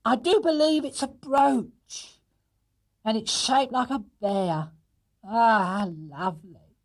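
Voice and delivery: high, slow, touched amazement; slow, high delighted wonder. Acted. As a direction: marvel at the bear brooch. Mrs Bird touched by brooch.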